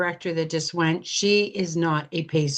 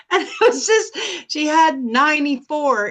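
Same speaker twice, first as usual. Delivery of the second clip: laughing through the words, pitched up; real amused laugh mid-sentence; 9 semitones higher, 3 dB louder than usual. Real, not acted. Laughs at funny customer story.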